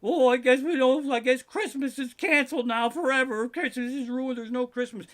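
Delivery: high, loud mocking imitation of complainers; mock despairing whiner. Mimicking people upset over a cancelled party, high mock wailing. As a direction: whine it high, hammed-up and put-on. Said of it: "it's like".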